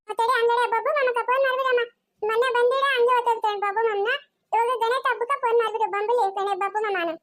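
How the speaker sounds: put-on high-pitched child making a request; requesting little child. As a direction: ask for it in a high kid voice. Child asks for phone call. (ಕೇಳಿಕೊಳ್ಳುವ ಪುಟ್ಟ ಮಗುವಿನ ಧ್ವನಿ)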